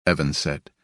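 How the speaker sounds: plain reading voice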